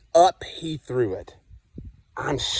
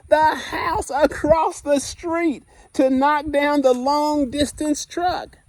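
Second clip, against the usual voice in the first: building excitement; 12 semitones higher, 3 dB louder than usual. Acted. Piling up list in story.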